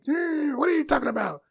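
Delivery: high mock heckling; mimicked objectors. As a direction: imitate people jumping down your throat. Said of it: "people were like".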